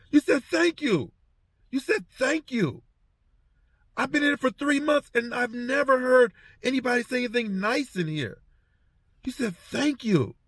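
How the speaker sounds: high, astonished and moved; amazed inmate. Retold or imitated. Inmate stunned to hear thank you from the guard, high amazed voice. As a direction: repeat it high in wonder. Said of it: "he's yelling".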